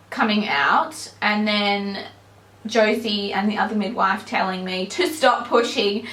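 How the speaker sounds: normal talking voice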